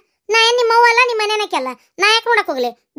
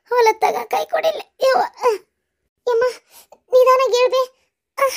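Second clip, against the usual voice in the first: tearful complaint; 4 semitones higher than usual. Acted. Old woman in pain, complaining.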